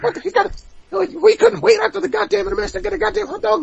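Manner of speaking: high, fast mocking boss rant; exasperated rant. Promoter fuming over the hot dog break, fast high mock rant. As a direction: spit it out in disbelief, fast and high. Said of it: "And it's just like".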